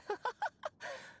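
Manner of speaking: bright high laugh of delight. Acted. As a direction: laugh with pleased amusement. Pleased after happy-ending remark.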